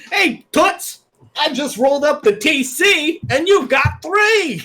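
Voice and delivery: very high, slow, laughing tough guy; hammed-up sleazy come-on; greaser tough guy. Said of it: "imitating the actor's line".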